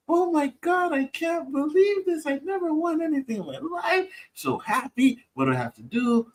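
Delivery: squealing high with joy; overjoyed victim. Retold or imitated. Caller gushing over her fake win. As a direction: gush like you just won big. Excited scam victim quoted.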